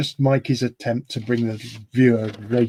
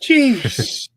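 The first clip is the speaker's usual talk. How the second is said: exasperated; 8 semitones higher than usual. Real, not acted. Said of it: "I've never heard [name] so upset".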